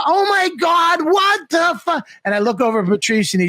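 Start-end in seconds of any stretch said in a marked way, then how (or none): 0.1-2.1 high, slow, shouted fake shock; faked surprise. Walking into his surprise party, faking a big shocked shout. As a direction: overact being caught off guard. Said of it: "I have to pretend".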